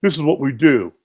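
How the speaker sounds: mock cocky oil-change guy; cocky mechanic. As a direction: boast like a know-it-all mechanic. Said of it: "he goes".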